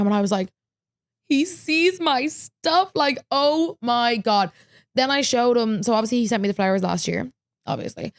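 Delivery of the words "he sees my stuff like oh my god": high, slow, awestruck squeal; starstruck. Retold or imitated. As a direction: drag it out in disbelief. Retelling starstruck reaction to idol.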